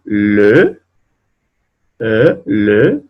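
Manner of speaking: le with a rounded French eu vowel; le en francés. Shown as a demonstration. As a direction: model le the French way for students. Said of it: "aquí tenemos algunos ejemplos".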